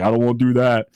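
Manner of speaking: lightly voiced laid-back kangaroo; a hint of a reluctant kangaroo. Narrator voices a reluctant kangaroo, lightly put-on voice. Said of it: "that's what kangaroos sound like in my brain".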